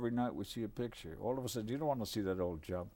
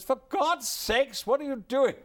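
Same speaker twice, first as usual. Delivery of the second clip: high, loud startled exclamation; imitating Chaplin snapping in surprise; Chaplin's outburst; 13 semitones higher, 9 dB louder than usual. Chaplin catching the guest tiptoeing, loud high exclamation.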